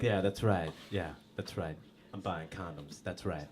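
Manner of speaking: low, very soft acted aside; quiet, deflated acknowledgement; subdued self-talk. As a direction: say it small and low, under your voice. Sheepish condom buyer in his mid-thirties.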